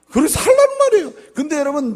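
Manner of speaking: very high, loud shouted preaching; full force preaching. Acted. Pastor exhorting the church, loud shouting preaching. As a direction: hammer the point home.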